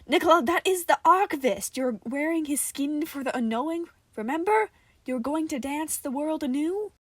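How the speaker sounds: loud, high, insistent reminder; exasperated scolding. Acted. Sarah loudly scolds Nicola about the ritual. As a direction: drill it into Nicola.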